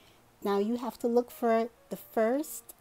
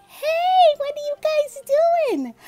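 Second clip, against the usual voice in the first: high, loud, happy hello; excited greeting; 12 dB louder, slower than usual. Real, not acted. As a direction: call out a big delighted hello. Greeting Sky loudly.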